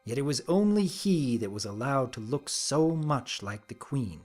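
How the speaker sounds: ordinary delivery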